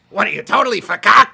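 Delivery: high, shouted clown impression; Krusty the Clown. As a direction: yell it at full volume as a manic clown. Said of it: "Krusty, half, half Pennywise".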